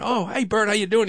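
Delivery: cheery phone greeting. Retold or imitated. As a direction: greet the caller with sudden delight. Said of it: "And I'm going".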